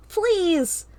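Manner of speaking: high, loud desperate plea; pleading scream. A narrator's reading. Carl begs as they are forced up, scream. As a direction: scream to be spared. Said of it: "Carl screams".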